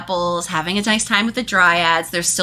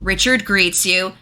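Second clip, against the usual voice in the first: ordinary delivery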